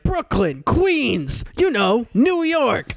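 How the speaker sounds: high mock tourist, slow at the end; clueless tourist. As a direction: play the tourist dim and vague. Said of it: "He's like".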